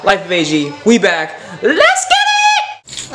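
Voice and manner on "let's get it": long, high stretched shout; hype shout. Real, not acted. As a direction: belt it out to kick things off. Hype start of ride.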